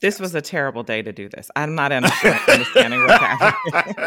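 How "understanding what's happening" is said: high squealing laugh through words; real laughter, much higher and louder. Real, not acted. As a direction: break into laughter mid-phrase. Laughing at host's chaos.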